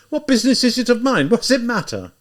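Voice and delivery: high, bewildered outburst; impatient shout. A narrator's reading. Zamyotov loses patience with Raskolnikov, high shout. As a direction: push him away with the words. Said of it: "he shouted suddenly".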